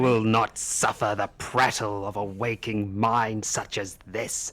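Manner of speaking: loud, imperious royal command; thundering kingly order; regal spectral king, wrathful. Acted. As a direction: cut off the prattle and demand submission.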